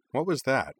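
put-on high, startled child voice; sudden alarm at a noise; startled question. Corwin hears a noise.

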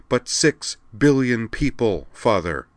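slow, high, emphatic protest; impassioned appeal. A narrator's reading. Princess appeals to her father for Earth, impassioned. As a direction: stretch and lift each word, appealing urgently. Daughter pleading for a world.

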